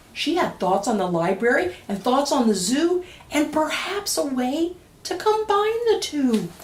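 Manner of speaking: lively, sing-song picture-book reading; reading to children. A narrator's reading. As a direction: read it aloud to a room of kids.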